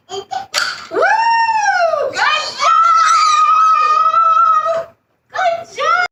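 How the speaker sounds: very high shouted praise; excited cheer. Real, not acted. Praising child's correct answer.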